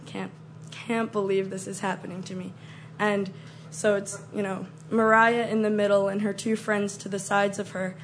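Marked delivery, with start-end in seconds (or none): 0.1-2.5 hushed disbelief. Retold or imitated. Scarer huddled in hiding as the star approaches, hushed disbelief. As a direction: say it softly to yourself, stunned. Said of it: "I was just like".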